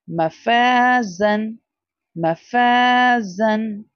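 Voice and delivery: मफाजन slow, जा drawn; stretched jaa. Shown as a demonstration. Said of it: "जा के बाद अलिफ है". (मफाजन में जा लंबा)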